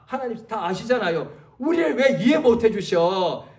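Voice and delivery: ordinary talk, nothing marked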